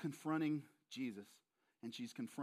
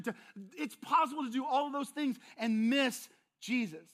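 louder, higher, building urgent warning; preaching at full force; 7 semitones higher, 9 dB louder than usual. Acted. Preacher warning the congregation about busyness, building and loud. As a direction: drive the warning home from the pulpit.

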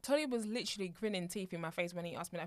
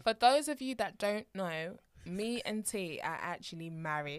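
slow announcement; slower than usual. Real, not acted.

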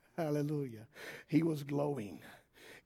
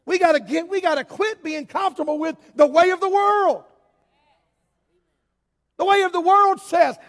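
loud, high, driving sermon cry; preaching at full force; 13 semitones higher, 15 dB louder than usual. Acted. Preacher warning the church about the world, full-force preaching. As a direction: preach it out over the congregation.

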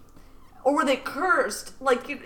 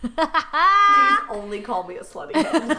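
laughing; 3 semitones higher, 4 dB louder, slower than usual. Real, not acted. Laughing joke reply.

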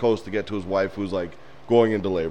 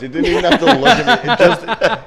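high, loud, helpless laughter in the words; laughing; 10 semitones higher, 7 dB louder than usual. Real, not acted. Objecting to friend's dog story, laughing hard. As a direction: crack up mid-objection.

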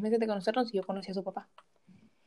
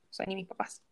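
softer repeat; 7 dB quieter than usual. Real, not acted. Softer echo to her friend about her ex. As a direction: drop the repeat to a soft, hurt murmur.

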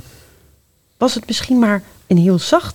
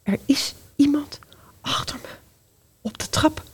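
frightened whisper; 5 semitones higher, 7 dB quieter than usual. Acted. Frightened, someone behind.

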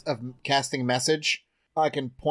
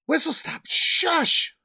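high hushed voice; 10 semitones higher, slower than usual. Acted. Character hushing companion.

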